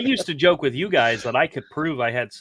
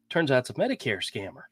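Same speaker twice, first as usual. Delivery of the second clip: normal talking voice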